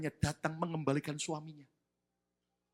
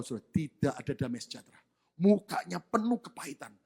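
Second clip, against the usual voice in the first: ordinary delivery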